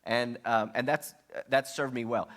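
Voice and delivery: ordinary talk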